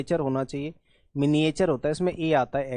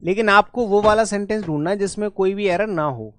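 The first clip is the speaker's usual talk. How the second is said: usual speaking voice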